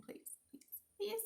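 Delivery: tiny, high mock begging; pleading. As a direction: plead in a small, soft, high squeak. Playfully begging the designers.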